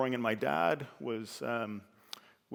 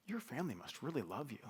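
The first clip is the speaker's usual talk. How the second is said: soft doctor; 9 dB quieter than usual. Retold or imitated. Doctor speaks softly to patient.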